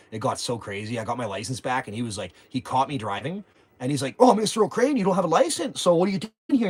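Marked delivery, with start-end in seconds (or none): 4.2-6.7 high, loud smug mock cop; smug imitation of the sergeant; gloating cop. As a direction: rub it in like a cocky cop who caught him.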